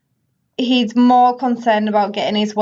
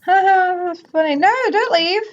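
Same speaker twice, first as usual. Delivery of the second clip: ordinary delivery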